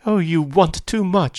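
high, pleading woman's outburst; distraught woman's cry. A narrator's reading. Daisy cries to Gatsby that he wants too much, distraught. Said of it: "she cried to Gatsby".

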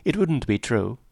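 usual speaking voice

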